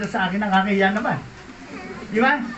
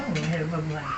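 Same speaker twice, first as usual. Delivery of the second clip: lower, softer sighing lament; quiet sigh; 8 semitones lower, 8 dB quieter, faster than usual. Retold or imitated. Poor crippled friend sighing over hardship, low and soft.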